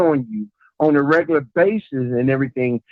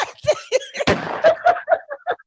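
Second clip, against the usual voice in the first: high wheezing laugh; helpless high-pitched laughing; laughter. Real, not acted. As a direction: crack up for a couple of seconds. Laughing after the smoke remark.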